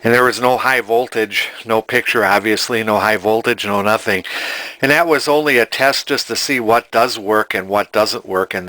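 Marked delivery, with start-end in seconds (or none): none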